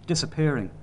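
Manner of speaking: usual speaking voice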